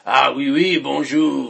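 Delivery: loud, hammed-up French; jokey French greeting, pushed loud; mock French accent. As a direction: burst into cartoon French. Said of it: "if I were to do a French accent".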